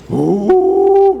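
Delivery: high, loud ooh of delight. Real, not acted. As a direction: savor the thought. Telling how good it was.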